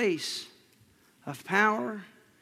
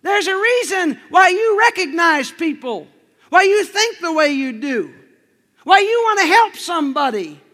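raised preacher's voice, much louder and higher; loud, high preaching; 8 semitones higher, 11 dB louder than usual. Acted. Preacher proclaiming to the congregation, loud and high. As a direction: proclaim it to the whole room.

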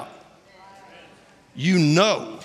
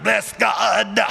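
granddaddy's preacher shout; 2 semitones higher than usual. Retold or imitated. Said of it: "Once my granddaddy started".

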